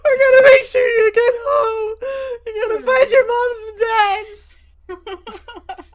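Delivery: hammed-up, high, loud weeping; wailing comfort, voice squeezed high with mock tears; sympathetic crying. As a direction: blubber reassurances at full volume. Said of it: "like, sympathetic crying for them".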